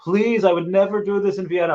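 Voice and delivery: high, pleading friend voice. Retold or imitated. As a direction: beg like an excited friend.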